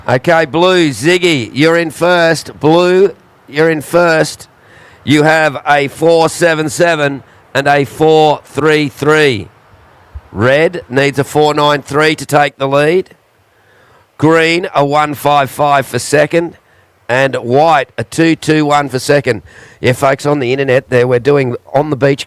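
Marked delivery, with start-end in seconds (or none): 0.1-2.6 slow, carrying call-out; calling out to surfers. Acted. 2.6-19.4 raised, slow call-out. Acted. Repeats standings louder to surfers.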